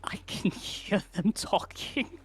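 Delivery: slow, haunted whisper. Acted. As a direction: breathe it out in terror. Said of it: "and he says".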